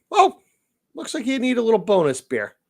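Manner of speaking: loud, high, sing-song woman's voice; a chirpy hostess pouring extra; imitating bartender. The bartender offering a bonus beer, in a high sing-song voice.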